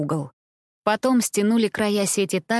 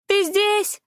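calling out; 13 semitones higher than usual. A narrator's reading. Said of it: "крикнула Импи".

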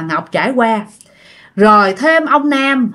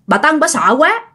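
usual speaking voice

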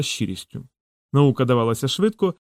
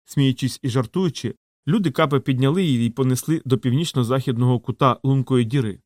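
ordinary delivery

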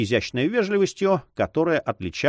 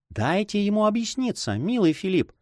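put-on a higher, dignified young woman's voice; noble young lady; 11 semitones higher than usual. A narrator's reading. Juana gently but nobly restrains Philippe from interrupting. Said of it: "с благородством сказала Хуана".

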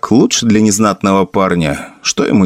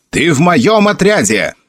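high-pitched indignant claim; a touch of outrage, pitch raised; angry outcry; 7 semitones higher than usual. A narrator's reading. Cas claims Felix for his own troop, protesting angrily. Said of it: "вскричал Кас".

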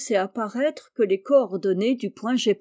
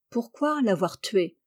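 usual speaking voice

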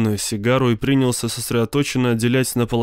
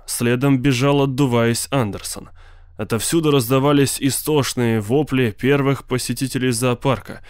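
ordinary delivery, nothing marked

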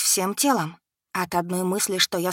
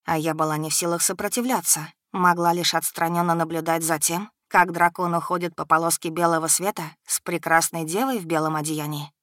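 ordinary delivery

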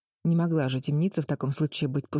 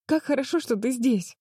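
put-on higher, happy girl's voice; joyful; 8 semitones higher than usual. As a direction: burst out in relief and joy. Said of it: "радовалась Флис".

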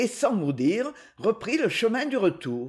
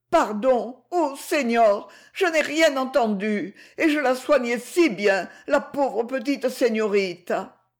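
voix de vieille nourrice plus aiguë, implorante et apeurée; nourrice qui balbutie; 5 semitones higher than usual. A narrator's reading. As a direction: supplier à genoux, en bégayant de peur. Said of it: "en balbutiant". (la nourrice, à genoux devant son maître, balbutie des excuses)

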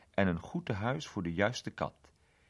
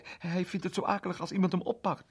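put-on higher, fretful little girl; anxious girl; 10 semitones higher than usual. A narrator's reading. Girl watching Mr Egg climb for her cat, higher and fretful. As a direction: hover anxiously over the rescue, voice raised.

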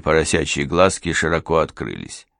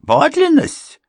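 much higher, loud alarmed echo; startled echo; 7 dB louder, slower than usual. A narrator's reading. Housekeeper alarmed at checking the will, high startled echo. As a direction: catch the word in shock. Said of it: "housekeeper startled".

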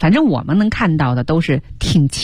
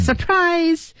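high-pitched quoted surprise cheer; birthday surprise; 11 semitones higher than usual. Retold or imitated. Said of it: "还说".

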